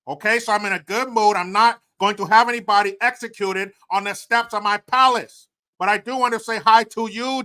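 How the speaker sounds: put-on loud Chinese-accented leader voice; Xi Jinping Chinese accent. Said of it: "That's it from the Chinese, uh, president".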